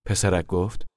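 ordinary delivery

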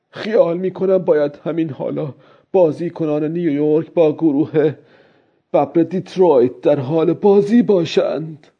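high-pitched character voice for inner thoughts; old fisherman thinking to himself. A narrator's reading. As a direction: voice the old man's musing, pitched well up.

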